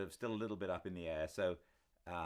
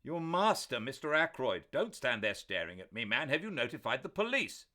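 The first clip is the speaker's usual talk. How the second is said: loud, much higher, urgent questions; urgent demand; 9 semitones higher, 10 dB louder than usual. A narrator's reading. Doctor urgently pressing Parker about the police. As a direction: press the butler hard.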